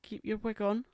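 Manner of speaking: high camp retort; high sassy tease. Acted. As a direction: fling it up high with sass.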